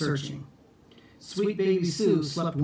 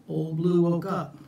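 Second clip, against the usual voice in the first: ordinary delivery, nothing marked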